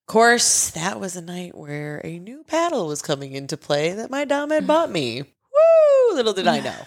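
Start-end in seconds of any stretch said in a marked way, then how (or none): 5.2-6.1 high, loud "woo"; excited whoop. Acted. Storyteller recalling the new paddle, whooping. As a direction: let out a high whooping cheer.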